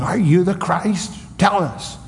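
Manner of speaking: taunting the prisoner with a high jeering voice; mock yelling council. Preacher voicing the council jeering at Jesus. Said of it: "they're all yelling".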